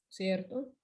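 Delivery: ordinary talk, nothing marked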